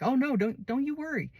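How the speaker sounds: high, airy reassurance; brushing it off. Retold or imitated. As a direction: wave away her sympathy. Too Tall reassuring Portia.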